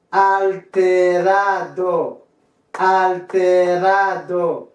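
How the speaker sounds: very slow Spanish word drill; pronunciation model. Shown as a demonstration. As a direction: stretch every syllable evenly, falling at each word's end. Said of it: "c'est pour ça prononcer".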